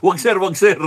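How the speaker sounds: high, anxious pleading; imitating the nervous organizers begging. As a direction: beg him not to, mock-worried. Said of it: "organizers pleading".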